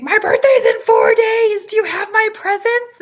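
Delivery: put-on high, loud, eager child voice; hammed-up birthday excitement; imitated excited kid. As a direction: shout it like an overexcited kid. Imitates son demanding birthday presents.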